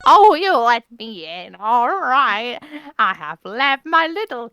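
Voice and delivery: slow put-on character voice. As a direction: read it as a game character. Voicing game character.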